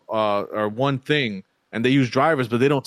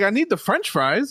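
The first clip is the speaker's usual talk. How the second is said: exasperated retelling; 9 semitones higher than usual. Retold or imitated. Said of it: "I was like".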